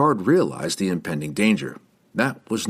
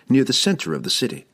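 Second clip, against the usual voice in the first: plain reading voice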